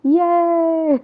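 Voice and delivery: high happy yay; a quick burst of delight; excited cheer. Real, not acted. Cheering that the volume is fixed.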